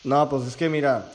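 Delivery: usual speaking voice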